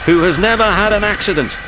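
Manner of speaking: indignant boast. Acted. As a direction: puff up proudly on Me.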